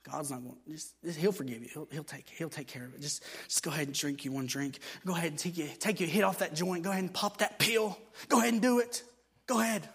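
soft, coaxing whisper-like tempter; tempting devil. Retold or imitated. Devil tempting a former alcoholic, softly coaxing. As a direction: lure them sweetly toward one drink. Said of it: "the devil starts getting in your head".

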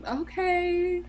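high, drawn-out deadpan okay; unbothered reply to the bro; unimpressed okay. Retold or imitated. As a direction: brush him off with a flat high okay. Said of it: "And I'm like".

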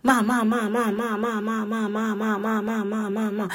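high, whiny Stewie-style chant of ma; imitating her kids. Mother imitating kids pestering her awake, high whiny chant. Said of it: "they say it just like that".

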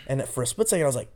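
ordinary talk, nothing marked

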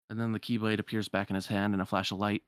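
plain reading voice

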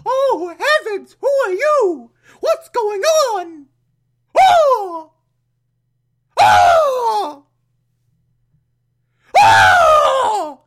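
high squealing aunt voice, shouting; shrieking flustered aunt; shrill old aunt. Retold or imitated. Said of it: "Aunt Harriet walks in and she's like".

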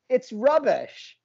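put-on a deliberately terrible British accent; mock British accent, done badly on purpose. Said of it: "That, that's how bad mine is".

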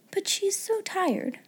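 put-on high, soft little-girl voice; high, gentle sympathy with "so" stressed; pitying thought. A narrator's reading. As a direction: pity her, high and soft, weight on "so". Sarah pities the sleeping maid.

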